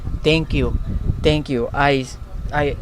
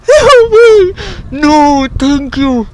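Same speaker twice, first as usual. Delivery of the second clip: loud, high, screaming with joy; overjoyed yell, pitch far up; ecstatic scream; 11 semitones higher, 10 dB louder, much slower than usual. Real, not acted. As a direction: shriek it out in disbelief. Screaming at the donation.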